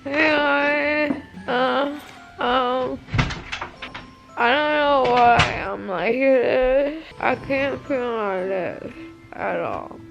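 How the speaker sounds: slow, soft, drowsy post-surgery voice; groggy from anesthesia. Real, not acted. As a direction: numb lips, dazed and sleepy. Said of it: "it's already wearing off her".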